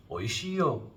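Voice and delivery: "Oishii yo" said high and kind; reassuring tone. Shown as a demonstration. Comforting a friend whose cake failed, reassuring. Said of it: "you can say".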